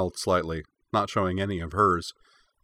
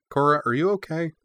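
lightly raised, concerned question; concerned check; 8 semitones higher, 3 dB louder than usual. A narrator's reading. Sokka checks on Cora after the commotion, concerned. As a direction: ask with concern, pitched up.

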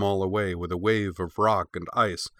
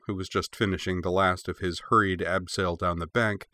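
usual speaking voice

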